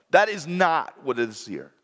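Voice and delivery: ordinary delivery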